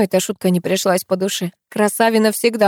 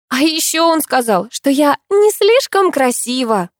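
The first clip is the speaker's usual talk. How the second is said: higher, slow, reluctant confession; heavy-hearted; 8 semitones higher, 4 dB louder than usual. Acted. Narrator confesses Alex called her not pretty, heavily. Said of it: "с тяжестью призналась я".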